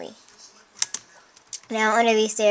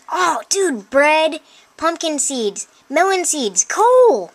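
high, loud whoop of delight; excited discovery; 5 semitones higher, 4 dB louder, slower than usual. Real, not acted. Player opens a chest full of loot, delighted shout. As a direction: shout it high at a happy find.

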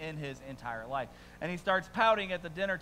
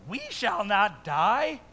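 higher, louder, slow impassioned prayer; Habakkuk's plea; 7 semitones higher, 8 dB louder than usual. Acted. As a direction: cry it to God like a prophet. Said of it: "this is Habakkuk's response now to God".

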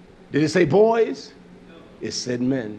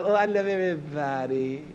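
mocking a hypocrite; 5 dB quieter than usual. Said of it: "We've been taught, oh, just, just say you love".

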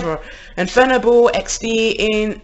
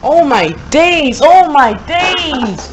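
loud, drawn-out shout, slow; shocked cry at the spinning tops; excited yell; 6 semitones higher, 9 dB louder, slower than usual. Real, not acted. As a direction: yell it in disbelief at the battle. Reacting to wild battle.

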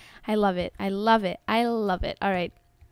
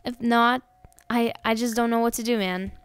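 ordinary delivery

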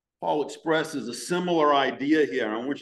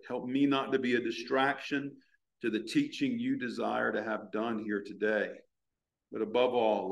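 lower-pitched, gently pleading prayer; earnest prayer; 4 semitones lower than usual. Real, not acted. Leads the congregation in prayer.

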